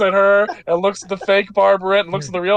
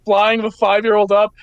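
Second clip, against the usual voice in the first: ordinary talk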